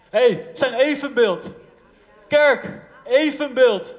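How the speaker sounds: preaching at full force. Real, not acted. Preacher calling out to his church, loud and slow. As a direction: hammer the point home to the church. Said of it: "preacher driving home point".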